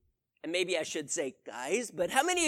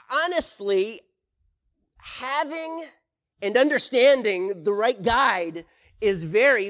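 preaching; 3 semitones higher, 7 dB louder than usual. Acted. Preacher drives home point.

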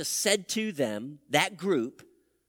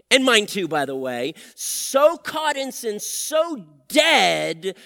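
full-force preaching; 4 semitones higher, 6 dB louder than usual. Acted. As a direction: preach it hard to the congregation. Preacher driving the point home.

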